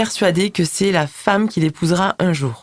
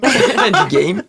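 slow teasing echo; 3 dB louder, much slower than usual. Pretend. Echoing the joke, drawn out.

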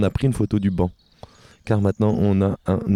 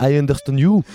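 higher, put-on French-accented English; French speaker's English; 5 semitones higher, 4 dB louder than usual. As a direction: mimic a Frenchman managing English. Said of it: "le français, il dira". (anglais imité à la française)

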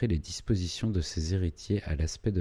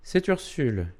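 short high-pitched retort; a touch of dryness in an old man's reply; curt, dry; 8 semitones higher than usual. A narrator's reading. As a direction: answer drily, voice raised. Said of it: "dit sèchement le vieillard".

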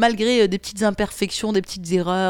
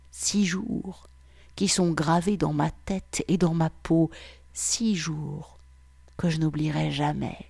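erotic story narration; 3 semitones lower than usual. A narrator's reading. Podcast host reading a listener's erotic story, intimate narration. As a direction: draw listeners in, sensual and unhurried.

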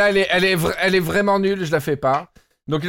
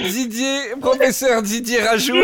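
laughing; 6 semitones higher than usual. Real, not acted. Laughing at the pun.

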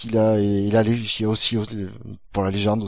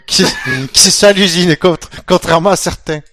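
laughing; 6 semitones higher, 11 dB louder than usual. Real, not acted. Laughing jab at a rival.

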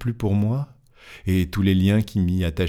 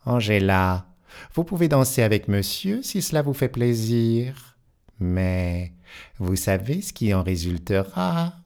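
a higher, distant, ringing voice from the clock; silvery clock voice; 3 semitones higher than usual. A narrator's reading. The clock's voice warning Angela before the dance, ringing and remote. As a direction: let the clock speak like a chiming bell, detached. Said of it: "la voix au timbre d'argent vibra".

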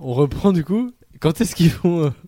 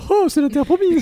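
high, amazed cry of wonder; awed high voice; 3 dB louder than usual. Pretend. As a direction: gasp it out like a revelation. Awed character exclaims promised land. (voix émerveillée très aiguë)

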